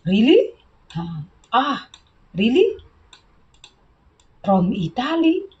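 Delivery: really can't believe it; surprised. Shown as a demonstration. Teacher showing surprise at Italy answer. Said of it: "So they surprised".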